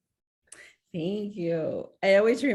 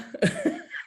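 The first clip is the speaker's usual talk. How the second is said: short rueful laugh. Real, not acted. Laughs at son's reply.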